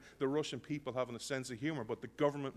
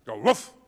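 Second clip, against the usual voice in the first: loud mock dog bark; a sudden loud woof; 2 semitones lower, 11 dB louder than usual. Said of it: "I bark".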